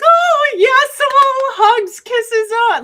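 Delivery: squealing high and loud with delight; gushing excitement. Retold or imitated. Husband's family greeting her, gushing and excited. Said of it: "lots of emotion".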